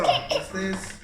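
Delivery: usual speaking voice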